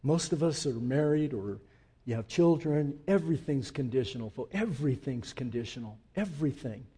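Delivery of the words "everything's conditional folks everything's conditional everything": quiet confiding. Acted. Preacher drops voice for confession.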